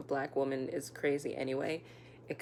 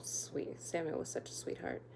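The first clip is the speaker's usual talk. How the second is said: soft fond whisper; whispering about a sweet ex; whispered fondness; 8 dB quieter than usual. Real, not acted. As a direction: breathe it out tenderly, almost voiceless. Fond aside about Samuel.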